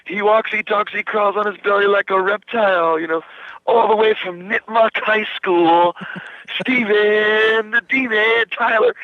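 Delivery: lightly voiced arena introduction, drawn out; rock announcer intro. Band intro on stage.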